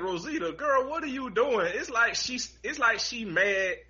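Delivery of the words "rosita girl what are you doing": exasperated scolding. Pretend. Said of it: "I'm like".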